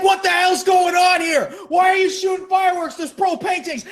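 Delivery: high, loud, fast yelling; mock furious yell; outraged dad. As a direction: storm in furious. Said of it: "It's like".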